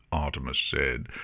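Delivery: ordinary delivery, nothing marked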